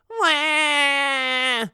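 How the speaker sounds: high wailing cry; child crying wail. Retold or imitated. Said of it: "others cried".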